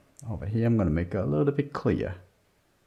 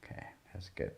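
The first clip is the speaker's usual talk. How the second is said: whispered approval to herself; 3 semitones lower, 16 dB quieter, faster than usual. Real, not acted. Teacher whispering approval of her own shading. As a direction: breathe it out softly, almost voiceless. Murmured approval while drawing.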